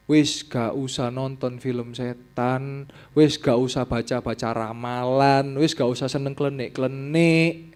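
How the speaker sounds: slow, deliberate warning; slow exhortation. Real, not acted. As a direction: drive each warning home slowly. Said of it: "preaching exhortation". (nasihat pelan dan tegas)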